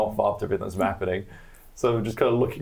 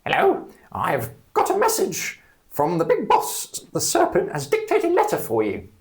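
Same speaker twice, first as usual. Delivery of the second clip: goblin messenger; 10 semitones higher, 4 dB louder than usual. Acted. Goblin messenger delivers letter.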